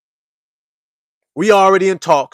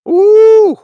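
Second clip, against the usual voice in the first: high loud squeal of delight; a long high ooh of pleasure; food-pleasure ooh; 8 dB louder than usual. Real, not acted. Savoring the food.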